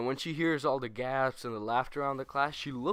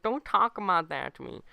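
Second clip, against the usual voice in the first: snappy, a bit higher and louder; annoyed; 6 semitones higher, 6 dB louder than usual. Retold or imitated. Annoyed classmate warning the teasers, raised voice. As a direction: bristle at being teased. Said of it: "He's like".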